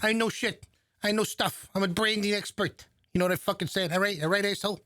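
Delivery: hammed-up New York swagger; New York accent. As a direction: bluster like a New York hotshot. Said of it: "his usual New York type shit".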